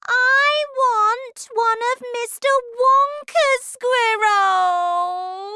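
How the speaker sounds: very slow, high, loud wail; drawn-out shriek of a demanding child; spoilt tantrum shout. Acted. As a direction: stretch it into a bratty demand. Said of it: "shouted Veruca".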